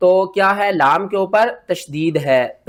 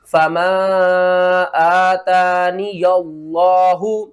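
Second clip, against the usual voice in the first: slow tajweed chant; Quran recitation; much slower than usual. Shown as a demonstration. Teacher reciting the verse, slow chant. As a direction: chant it slowly.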